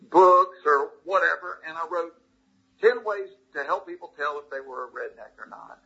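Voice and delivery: ordinary talk, nothing marked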